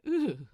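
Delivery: short loud groan of disgust; disgusted ugh. A narrator's reading. Angel shuddering at haunted house memory, loud disgusted ugh.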